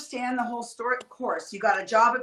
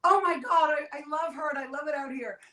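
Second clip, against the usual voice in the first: gushing fan; 5 semitones higher than usual. Retold or imitated. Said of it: "he was like".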